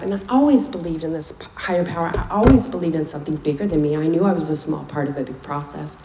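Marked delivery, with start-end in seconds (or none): none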